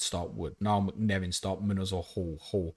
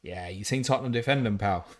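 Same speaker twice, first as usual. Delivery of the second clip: ordinary talk, nothing marked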